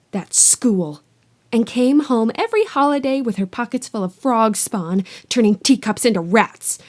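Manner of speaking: loud, bitter ranting aunt. Acted. Aunt ranting to Harry about her witch sister, loud. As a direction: rant like years of resentment bursting out. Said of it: "went ranting on".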